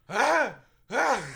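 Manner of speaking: mock sneeze. Shown as a demonstration. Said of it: "I just tilt my head and go".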